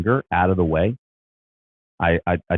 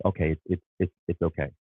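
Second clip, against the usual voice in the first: softer, gentle reassurance from the body; soothing inner voice; 5 dB quieter than usual. Retold or imitated. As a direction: ease it out softly, like calming yourself. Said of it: "your body is saying".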